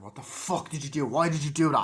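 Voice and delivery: mock-outraged demand, rising to loud; retold angry outburst. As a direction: act out confronting her in disbelief. Acts out man confronting the woman.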